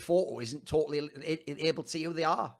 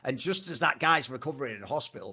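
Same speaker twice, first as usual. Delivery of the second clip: ordinary talk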